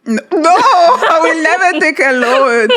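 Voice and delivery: high, drawn-out refusal through laughter; laughing denial. Real, not acted. Guest pressed about taking a loan, laughing as she denies it.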